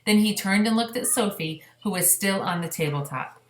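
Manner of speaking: plain reading voice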